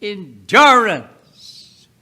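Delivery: normal talking voice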